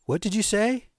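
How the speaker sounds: high, rising parental challenge; sharp rise, mom interrogating; questioning mother. Retold or imitated. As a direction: pitch it up like a mom who heard a swear. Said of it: "So my ma says".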